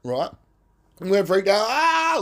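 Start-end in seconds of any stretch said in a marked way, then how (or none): 1.7-2.2 high, short, startled yelp; a quick high shriek of fright; mock terrified cry. Shown as a demonstration. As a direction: let out a sudden scared yelp. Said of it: "like this".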